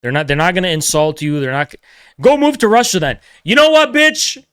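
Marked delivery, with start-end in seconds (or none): 2.2-4.4 high, louder jeering voice; shrill-pitched mocking retort; mock heckler. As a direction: sneer it like a hostile critic. Imagined heckler mocking him.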